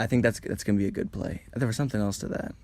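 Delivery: ordinary talk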